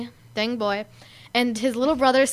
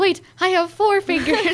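put-on loopy kid; 6 semitones higher, 4 dB louder than usual. Quoting loopy David.

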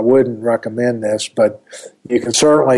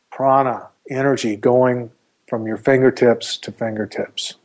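calm, unhurried meditation voice; guided meditation. Acted. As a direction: ease the listener into calm.

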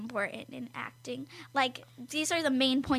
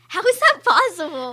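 loud, high, laughing disbelief; incredulous; 5 semitones higher, 12 dB louder than usual. Real, not acted. Reacting to co-host's story about her sister's accent, loudly incredulous. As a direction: blurt it out in amused disbelief.

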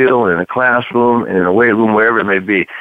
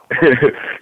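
laugh; 4 semitones higher than usual. Real, not acted. Laughing mid-story.

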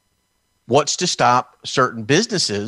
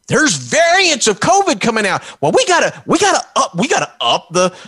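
mock panic; 9 semitones higher, 4 dB louder than usual. Mocking officials' panic.

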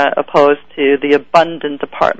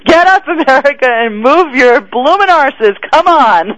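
rallying shout; 8 semitones higher than usual. Pretend. Repeated rally call, louder.

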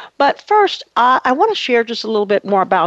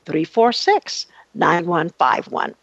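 slowed right down, number by number; slow, careful repeat; 3 semitones lower, slower than usual. Shown as a demonstration. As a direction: read the digits slowly and clearly. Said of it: "Again, that's one eight eight eight".

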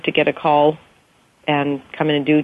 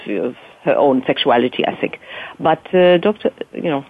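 Doctor Obrecht as a French lady, French accent; 3 semitones lower than usual. Shown as a demonstration. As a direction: put on a French accent, feeling for the words. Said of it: "if, uh, Doctor Obrecht was French".

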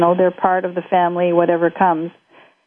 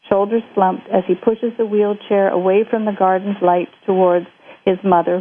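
plain reading voice

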